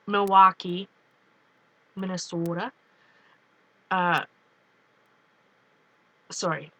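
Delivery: ordinary delivery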